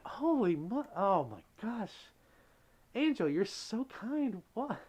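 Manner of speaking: high, loud gasp of disbelief; real shock at a gift; high gasping surprise over a big tip; stunned by a donation. Real, not acted.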